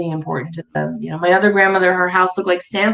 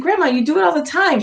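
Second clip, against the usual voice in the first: put-on lightly higher teenage protest; 9 semitones higher, faster than usual. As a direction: play the cheeky granddaughter answering back. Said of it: "I said".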